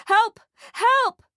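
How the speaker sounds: high, loud cries for help; frantic, panicked mother; shouting. A narrator's reading. Mother cannot see her son, shouting for help. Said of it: "shouts the woman".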